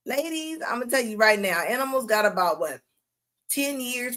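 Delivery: ordinary talk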